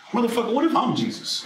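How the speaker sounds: high-pitched startled self-talk; sudden realization. Acted. Kid says to himself he might be Jesus, sudden realization. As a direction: blurt it high, as a wild new idea. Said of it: "So I said to myself".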